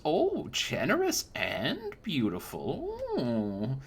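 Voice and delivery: put-on high, sweet old woman teasing; a crone batting her lashes at a customer; high granny voice, playfully flirty.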